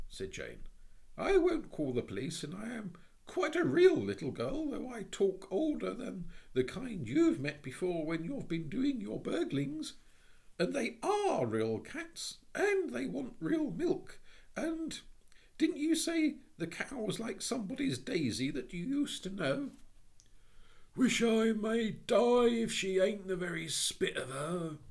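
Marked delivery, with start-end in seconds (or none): 1.2-19.7 put-on high, bright child's voice; a high little-girl voice. 21.0-24.8 rough Cockney burglar, pitched up and loud; a loud, cornered Cockney burglar. Acted.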